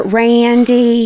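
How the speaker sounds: sweet-talking Georgia drawl; Georgia accent. Shown as a demonstration. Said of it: "talking like she was from Georgia".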